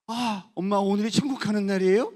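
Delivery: put-on boy asking his mother about heaven; wondering question. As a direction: ask it in childlike wonder. Son asks his mother.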